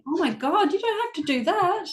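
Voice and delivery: bursting out that it's not needed; exclaiming in disbelief. Retold or imitated. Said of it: "And I'm like".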